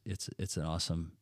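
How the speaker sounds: ordinary delivery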